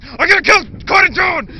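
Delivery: high, loud mock rage; furious shout. Dad in the story yelling his threat in a mock furious shout. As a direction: scream the threat at full volume.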